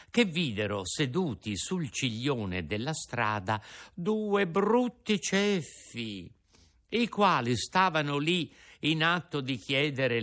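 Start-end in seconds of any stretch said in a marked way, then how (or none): 3.9-6.3 slow, scornful drawl; mock disgust. A narrator's reading. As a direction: linger on the ugly faces. Narrator mocks the pair.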